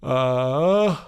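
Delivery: a real yawn through the word. Real, not acted. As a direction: yawn it out mid-sentence. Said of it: "sorry, yawning".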